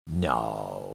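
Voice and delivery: low, hushed, drawn-out no; retold disbelief, sinking low and soft; disbelieving no. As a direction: let a long no drop right down.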